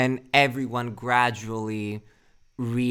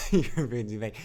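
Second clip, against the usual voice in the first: laughing; 9 dB louder, faster than usual. Real, not acted. Laughing jab at chatter.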